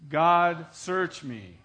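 God, search me called out loud; shouted prayer line for the congregation to echo; loud congregational call. Shown as a demonstration. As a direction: lead the room in a loud call. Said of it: "Say it again".

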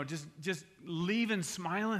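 usual speaking voice

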